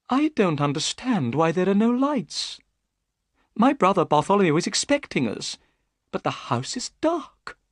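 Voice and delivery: worried host puzzled by the dark house; anxious Thaddeus. A narrator's reading. Thaddeus voiced in the story.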